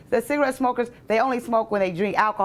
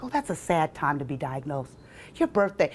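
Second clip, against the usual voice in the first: softer, lower pitying voice; quiet pitying murmur; mock sympathy; 5 semitones lower, 5 dB quieter than usual. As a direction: play the sad condolence. People were like.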